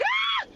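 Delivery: very high, short mock scream; sudden high squealed scream; demonstrated scream. Shown as a demonstration. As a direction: let out a high-pitched "Ah!" scream without full volume. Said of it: "just scream from there and be like".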